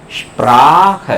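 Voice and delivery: Sprach with a sh sound, ʃprak, drawn out slowly; German pronunciation. Shown as a demonstration. Teacher modelling स्प्राख for German learners. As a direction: say स्प्राख as shprakh, stretched slowly. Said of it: "पूरा देखो उसको".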